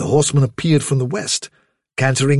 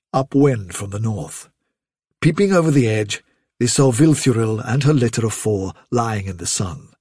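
usual speaking voice

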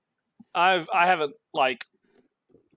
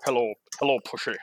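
Sean Connery impression; 6 semitones lower, faster than usual. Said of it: "You kinda sound a little bit like Sean Connery".

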